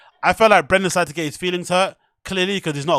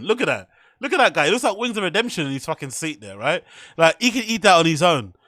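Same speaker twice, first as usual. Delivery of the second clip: ordinary delivery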